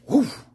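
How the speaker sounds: high imitated whoosh; quick high-pitched whoof of air; throwing whoosh. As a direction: voice a bottle flying off. Imitates a bottle being thrown.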